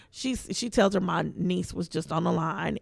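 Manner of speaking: ordinary talk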